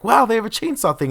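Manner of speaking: high, loud sarcastic wow; over-the-top thrilled about the chainsaw; mock excitement. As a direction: gush with fake delight. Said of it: "And I'm like".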